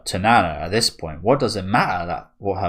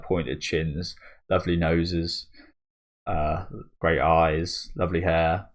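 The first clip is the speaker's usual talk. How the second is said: ordinary delivery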